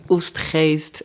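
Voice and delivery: Oostgeest said the Dutch way, long OO; Dutch town name. Shown as a demonstration. To the host, correcting how he said the town, Oostgeest in Dutch. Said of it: "Ich sage, äh".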